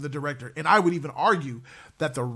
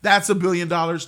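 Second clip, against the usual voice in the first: ordinary delivery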